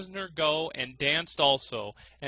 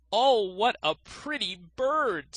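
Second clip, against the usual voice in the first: put-on high, slow old miser; 7 semitones higher, 4 dB louder, slower than usual. A narrator's reading. Old miser admiring the thrush, high and slow. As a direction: coo over the bird like a greedy old man. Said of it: "said the miser".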